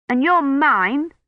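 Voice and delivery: put-on boy stubbornly throwing it back. A narrator's reading. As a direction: dig in his heels like a boy. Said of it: "Colin said stubbornly".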